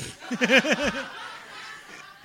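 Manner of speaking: high laugh as the cop; hearty laugh. Retold or imitated. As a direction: laugh in the cop's voice. Cop laughing in retold story.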